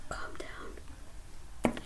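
very soft, breath-only whisper; hushed, barely audible. Pretend. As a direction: whisper it as if soothing someone. Hushed calming before yelling.

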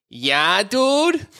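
high, laughing parrot call; mimicking parrot. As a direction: chirp it like a bird. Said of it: "we start going".

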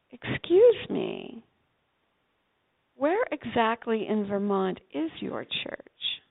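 slow, measured polite question; slow, drawn-out excuse me. Retold or imitated. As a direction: stretch out a prim, deliberate question. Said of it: "she's been silent the whole time".

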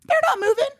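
exasperated squeak. Real, not acted. Complaining about New Yorkers.

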